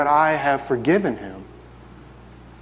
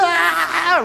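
high mock child whimper; 13 semitones higher than usual. Child voice breaks into cry.

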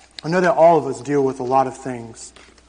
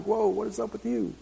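higher, softer amazed whoa; playful astonished reaction; mock amazement; 6 semitones higher, 9 dB quieter than usual. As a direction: act bewildered at them. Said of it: "And I'm like".

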